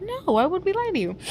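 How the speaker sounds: high-pitched, quick protest; mock protest. Reassuring Norman that we wouldn't lie, high protest.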